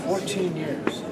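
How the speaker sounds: dropped low and quiet; quiet, sunk-down take; low and soft. Shown as a demonstration. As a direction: bring it down low. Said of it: "Come up, come down, go down, low".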